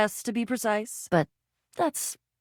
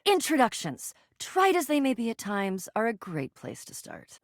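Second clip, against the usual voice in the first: normal talking voice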